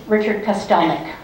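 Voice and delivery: usual speaking voice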